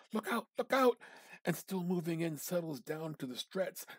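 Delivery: high, loud cry of warning; alarmed cry. A narrator's reading. Narrator warning the sick man's toy, loud alarmed cry. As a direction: shout the warning as it crashes.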